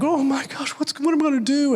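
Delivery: high-pitched mock panic; panicked. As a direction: act out a groomsman in a high flap. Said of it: "they're panicked".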